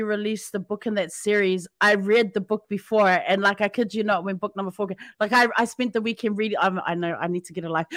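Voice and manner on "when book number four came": usual speaking voice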